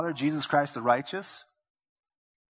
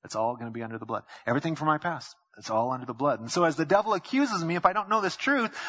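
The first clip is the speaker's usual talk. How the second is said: usual speaking voice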